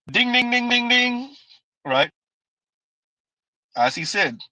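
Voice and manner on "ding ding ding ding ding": high, ringing ding-ding-ding; quick repeated high-pitched dings; imitated winner's bell. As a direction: chime it like a correct-answer bell. Imitates a bell for right answer.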